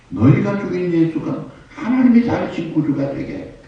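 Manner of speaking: high, rising preacher's cry; bold proclamation. Acted. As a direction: call the crowd to repent, ending loud. Acts out Stephen's proclamation. (높고 힘차게 외치듯 선포)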